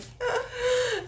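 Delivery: short high laugh; real laugh. Real, not acted. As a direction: laugh at your own stutter. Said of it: "laugh at my stuttering".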